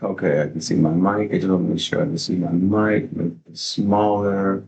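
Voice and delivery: usual speaking voice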